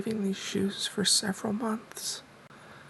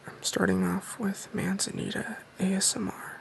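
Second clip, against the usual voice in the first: hushed whisper for a Q and A video; soft-spoken whisper. Real, not acted. Host whispers his Q and A to viewers, soft-spoken. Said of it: "I'm doing soft-spoken in this video".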